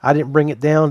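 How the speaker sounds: normal talking voice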